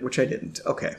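usual speaking voice

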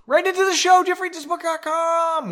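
very high, loud mock plug; announcer plug. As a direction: shriek out the plug in a put-on voice. Mock announcer plug mid-story.

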